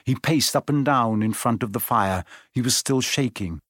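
ordinary delivery, nothing marked

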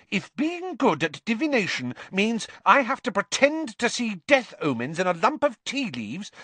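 high-pitched, heated tirade; indignant outburst. A narrator's reading. Girl stung by taunt about being rubbish, indignant. Hermione snaps after nerve is touched.

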